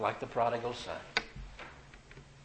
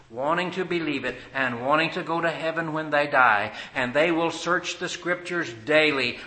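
full-force preaching; 8 dB louder than usual. Acted. Preacher urging the congregation to search scripture, loud and building. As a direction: drive the gospel home to the congregation.

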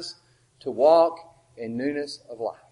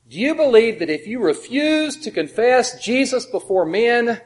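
higher, louder pointed questioning; questioning a stranger; 6 semitones higher, 7 dB louder, slower than usual. Retold or imitated. Preacher questions a man on the street, pointed.

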